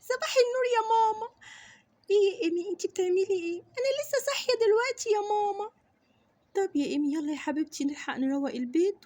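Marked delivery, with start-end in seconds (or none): 0.0-1.3 put-on higher, child voice waking up; sweet little daughter greeting mum; little girl. Little girl answers mother. 3.6-5.7 put-on higher girl's voice, just woken; little girl. Daughter just woke up.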